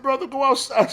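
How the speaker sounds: high, loud mock yell; exasperated shout. Father yelling at grown son to just go outside. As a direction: bark it at him in disbelief. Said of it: "I said".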